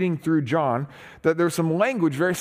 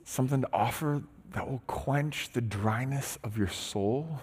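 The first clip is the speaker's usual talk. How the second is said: hushed intimate; 2 semitones lower, 9 dB quieter than usual. Retold or imitated. Jesus' offer voiced intimately.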